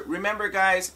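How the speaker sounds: usual speaking voice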